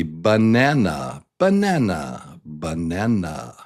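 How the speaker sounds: banana as ba-NAN-a, for repeating; stressed middle syllable. Shown as a demonstration. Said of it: "Say it with me".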